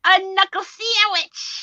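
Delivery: high, loud and slow, teasing; playful shout. Real, not acted. Host shouting the joke answer to the audience, high and teasing. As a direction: belt out the punchline, drawn out.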